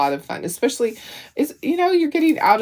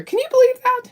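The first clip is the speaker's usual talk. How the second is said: incredulous outburst; faster than usual. Retold or imitated. Husband's outraged quote, retold.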